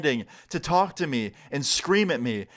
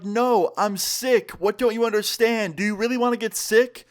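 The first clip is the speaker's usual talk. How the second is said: acted exasperation, higher pitched; exasperated protest; 8 semitones higher than usual. Sick man arguing from his balcony, mildly acted exasperation. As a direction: plead your case in mock disbelief.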